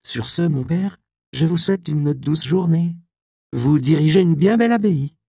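high, bright, loud ironic courtesy; mock-sweet farewell. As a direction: sweeten it to twist the knife. Robin prend congé, ironique. (adieu faussement aimable, aigu et fort)

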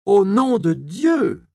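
high, loud and slow churchman's cry; outraged canon. A narrator's reading. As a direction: call down God's name, drawn out. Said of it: "surenchérit le chanoine". (le chanoine surenchérit, cri lent, aigu et fort)

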